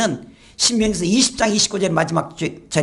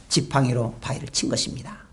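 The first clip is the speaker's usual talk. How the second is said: hushed whisper; 9 semitones lower, 6 dB quieter than usual. Acted. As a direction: whisper it, softer, like a secret. Preacher dramatizes Moses striking rock.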